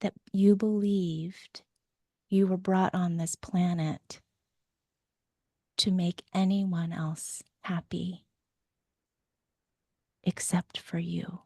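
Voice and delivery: put-on hushed loving words to a small child; tender comforting. Shown as a demonstration. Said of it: "and go".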